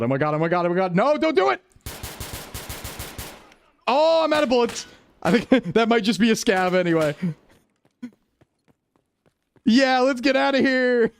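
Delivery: high-pitched panicked shrieking; shrieking jackpot winner. Real, not acted.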